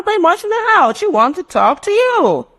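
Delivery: put-on very high little child's voice; child's reply. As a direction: sound like a small kid. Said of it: "child's answer".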